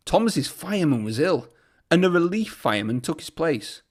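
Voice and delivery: ordinary delivery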